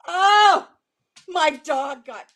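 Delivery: loud crying wail; anguished cry. Real, not acted. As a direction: wail in real dismay. Dog got sprayed.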